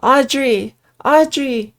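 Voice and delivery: loud, rising desperate calls; calling out. Acted. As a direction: shout her name into the fog. Calling for lost friend.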